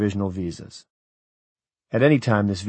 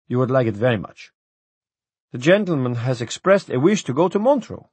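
higher, pushy official pressing his case; coaxing official; 5 semitones higher, 3 dB louder than usual. A narrator's reading. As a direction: wheedle the traveller to stay.

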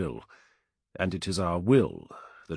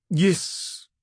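higher-pitched captain's confirmation; commander's reply; 10 semitones higher, 4 dB louder than usual. A narrator's reading. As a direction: answer the young soldier as her commander. Captain answers the standard-bearer.

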